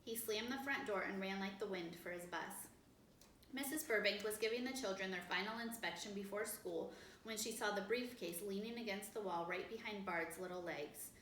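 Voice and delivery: ordinary delivery, nothing marked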